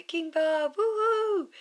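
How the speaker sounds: high, loud cheering whoop; crowd cheer. Acted. Crowd whooping for their new king. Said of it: "they cry".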